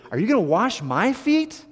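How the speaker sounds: Peter's protest. Retold or imitated. Peter voiced protesting to Jesus.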